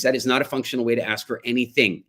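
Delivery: usual speaking voice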